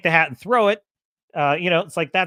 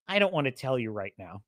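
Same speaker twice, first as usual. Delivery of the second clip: low, soft mock brush-off; sullen refusal; 7 semitones lower, 7 dB quieter than usual. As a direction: imitate her closing the subject, dropped and quiet. Retold refusal that kills dialogue.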